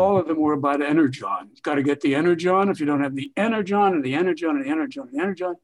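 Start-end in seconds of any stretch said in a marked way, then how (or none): 1.5-5.6 fast, droning run-on parody; mocking, hurried repetition; mock repetitive dialogue. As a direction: rattle it off like tediously repetitive lines. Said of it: "they were tremendously repetitive".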